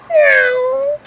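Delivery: high, drawn-out meow; high cartoon meow; cat meow. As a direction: mew like a little cat. The cat meows.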